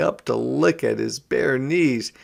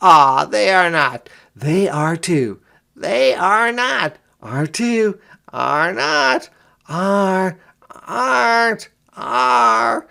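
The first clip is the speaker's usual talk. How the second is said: high, loud sing-song back-and-forth squabble; childish bickering; 9 semitones higher, 6 dB louder, slower than usual. A narrator's reading. Brother and sister bicker over dogs and cats. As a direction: trade are-nots like bratty kids.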